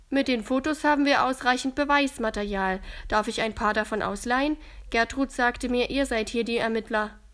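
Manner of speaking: leicht gespielter junger Mann, deutlich lauter; Daniel voiced. A narrator's reading. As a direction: sachlicher Versicherungsmann, kräftig. Daniel's voice in the story. (Daniel spricht mit Gertrud, laute Männerrolle)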